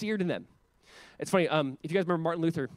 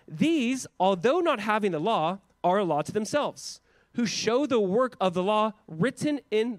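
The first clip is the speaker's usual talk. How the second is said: slow, raised scripture reading; 4 semitones higher, 3 dB louder than usual. Acted. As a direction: read it out slowly like scripture. Reads scripture aloud.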